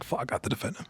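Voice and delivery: breathy whispered thought. Retold or imitated. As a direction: whisper it to yourself. Retold inner thought in a fight.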